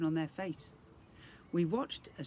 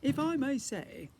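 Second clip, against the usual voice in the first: high, louder refined lady's voice; genteel ghost lady; 12 semitones higher, 7 dB louder than usual. Acted. Ghostly Lady Leonora soothes the prince, refined. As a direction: cut in with polished courtesy.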